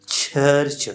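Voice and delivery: church said distinctly, each ch stopped then released; affricate demo. Shown as a demonstration. Teacher showing students an affricate, church with crisply released ch. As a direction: isolate church as a careful model word. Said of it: "फिर जो है release करना".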